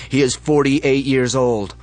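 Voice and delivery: loud, slow shouted announcement; leader's yell. Acted. Leader calls out to the crowd, slow loud yell. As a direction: call it out over the basement crowd. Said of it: "The leaders yell".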